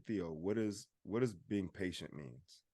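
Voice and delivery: a father softly quizzing his son; soft-spoken. Retold or imitated. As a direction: gently quiz a child, quietly. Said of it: "I say, I ask him".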